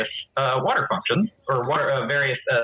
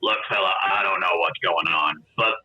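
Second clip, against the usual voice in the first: much lower, gravelly-free low grumble of a harried barkeep; gruff bartender; 7 semitones lower than usual. Acted. Bartender telling the Goliath there's no water, low. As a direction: brush him off, low and weary. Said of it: "he's like".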